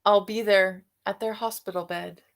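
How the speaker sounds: plain reading voice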